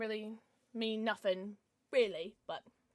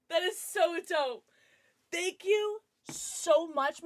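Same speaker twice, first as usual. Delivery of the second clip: slow, higher, delighted thanks; drawn-out delighted gratitude; gushing thanks; 6 semitones higher, 4 dB louder, much slower than usual. Real, not acted. Streamer thanking a viewer for a gift, gushing.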